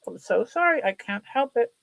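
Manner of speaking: normal talking voice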